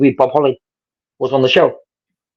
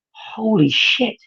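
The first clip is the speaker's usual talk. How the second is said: awestruck; 9 semitones higher, 5 dB quieter, much slower than usual. Retold or imitated. Awestruck inner thought.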